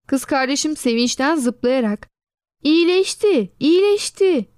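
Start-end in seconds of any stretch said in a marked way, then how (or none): 2.6-4.5 higher, jumping-for-joy cry; joyful shout. A narrator's reading. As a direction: shout the good news, bouncing. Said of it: "diye bağırıyordu".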